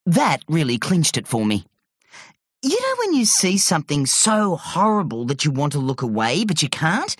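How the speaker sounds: ordinary delivery, nothing marked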